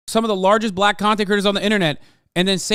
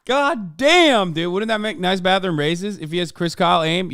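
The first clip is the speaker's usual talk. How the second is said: usual speaking voice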